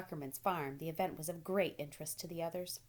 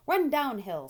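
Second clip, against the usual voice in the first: loud, higher cow call; 7 semitones higher, 10 dB louder, slower than usual. A narrator's reading. Cows calling advice to Wilbur, loud. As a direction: shout it like a cow in the pasture. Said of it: "said the cows".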